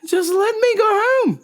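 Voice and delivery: high mock whimper; high whiny plea. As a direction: whine to be let home. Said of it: "I was like".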